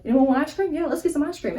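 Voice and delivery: bright high eager offer. Retold or imitated. Dirty hungry family suggests ice cream, eager. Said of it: "we were like".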